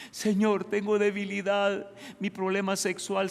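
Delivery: acted cry for help; pleading believer. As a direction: call out to the Lord as a struggling believer. Acts believer crying out to God.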